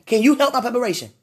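quick, insistent ask. Real, not acted. Repeated as a faster plea.

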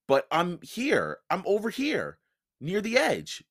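plain reading voice

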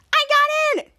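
high, thrilled acted shout; excited squeal. Charlotte telling Henry she got in, high squeal. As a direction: burst out with good news.

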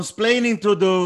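[name] impression. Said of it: "they always quote him saying".

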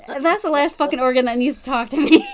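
laughing through the punchline; loud, laughing exclamation. Real, not acted. Laughing at own joke.

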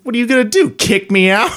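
high, loud mocking dare; taunting challenge. Taunting the mall guard, high and loud mocking dare. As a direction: goad him, daring.

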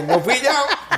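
very high put-on old lady voice; grandmother in heaven. Grandma in heaven insisting it wasn't her, very high voice. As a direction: squeak it out like a granny denying blame. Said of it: "la abuelita como que".

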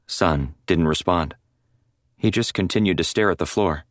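ordinary delivery, nothing marked

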